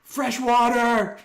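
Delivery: slow, high, loud yell; panicked yell. Acted. Renard shouting the panic word, high and slow. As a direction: drag out the panic word at full voice. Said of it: "yelling the panic word".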